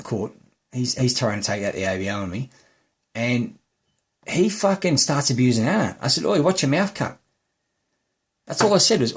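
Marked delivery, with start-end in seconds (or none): 6.2-7.2 higher-pitched indignant retold warning; sharp, raised-pitch rebuke. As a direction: re-enact telling someone off. Retold courtroom telling-off of neighbour.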